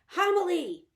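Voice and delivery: high, loud call of her name; boy calling Homily from above. Acted. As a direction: call down into the hole.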